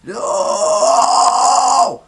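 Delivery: long, loud scream from the diaphragm; pushed raspy scream. Shown as a demonstration. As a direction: push the rasp hard and hold it. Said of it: "push it more with your diaphragm".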